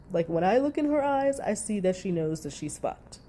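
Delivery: normal talking voice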